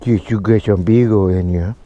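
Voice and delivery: ordinary delivery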